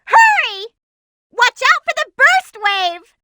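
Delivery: high, loud urging shout; urgent shout. Acted. Urging teammates as sections explode, loud shout. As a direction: yell at the team to hurry.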